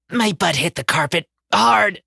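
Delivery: plain reading voice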